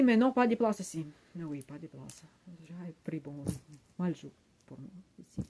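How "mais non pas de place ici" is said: louder, higher objection; mock protest. Passenger refusing in a quarrel over bus seats, mock protest. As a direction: protest as the other passenger, raised voice.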